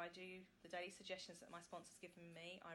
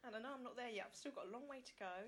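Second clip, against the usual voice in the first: getting emotional; 3 semitones higher, 4 dB quieter than usual. Real, not acted. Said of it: "Now I'm getting emotional".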